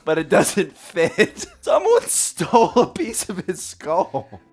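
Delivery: slow, laughing through the words; can barely get it out for giggling. Real, not acted. Cohost telling the stolen skull story, laughing.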